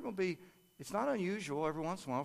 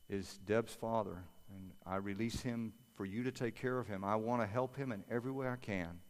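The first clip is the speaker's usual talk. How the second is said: soft, earnest spoken prayer; 3 semitones lower, 4 dB quieter than usual. Acted. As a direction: pray it gently and humbly, as if to God. Pastor leads prayer for surrender.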